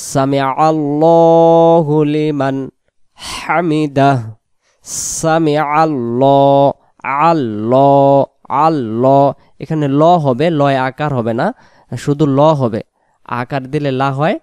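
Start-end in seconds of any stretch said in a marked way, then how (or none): 0.1-9.2 সামি আল্লাহু লিমান হামিদাহ very slow, আল্লাহ stressed; slow clear recitation. Shown as a demonstration. Teacher giving the clear form slowly, stressing আল্লাহ. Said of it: "স্পষ্ট করে আমরা কি বলবো".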